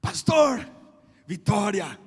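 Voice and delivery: loud, jubilant announcement; excited call. Retold or imitated. Young man calling the pastor to announce victory, jubilant. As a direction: burst out with the good news.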